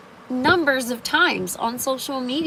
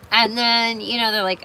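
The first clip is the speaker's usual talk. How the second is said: ordinary talk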